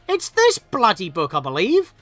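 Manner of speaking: ordinary talk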